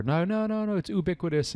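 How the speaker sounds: high, emphatic no no no; insistent denial. Retold or imitated. Retelling how she insisted to a colleague, high and emphatic. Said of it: "I swore to her".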